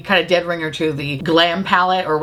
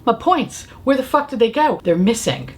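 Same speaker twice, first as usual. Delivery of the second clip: ordinary talk, nothing marked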